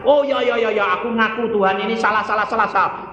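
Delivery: rapid, high acted admission; preacher imitating a flustered penitent; mock hurried confession. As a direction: tumble out the repeated words as if caught out. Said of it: "Setelah dosa-dosa diperiksa".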